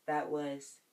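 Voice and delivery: usual speaking voice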